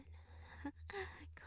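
high breathy mocking giggle; whispered giggle. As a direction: giggle at their struggle. Mocking the struggle.

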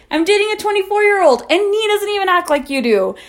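high, indignant rant; retold exasperated telling-off; outraged scolding.

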